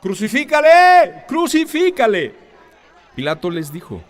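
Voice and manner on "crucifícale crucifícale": much higher, louder, slow furious crowd shout. A narrator's reading. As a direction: roar it like an angry mob demanding death. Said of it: "dieron voces diciendo".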